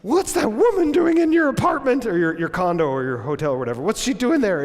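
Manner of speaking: put-on high, loud accusing girlfriend voice; mock jealous demand; upset girlfriend. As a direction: confront him, rising in pitch. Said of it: "she's like".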